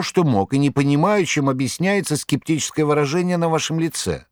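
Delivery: high, fussy tomcat voice pleading his case; a boastful cat protesting his heroics, high; cat Behemoth. A narrator's reading.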